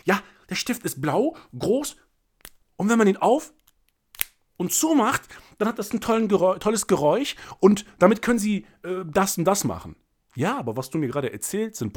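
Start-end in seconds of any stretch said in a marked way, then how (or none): 0.0-10.0 higher and louder, eager product pitch; mock salesman reeling off pen features; pushy salesman. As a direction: ham up a salesman rattling off features. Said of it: "Sie würden sagen".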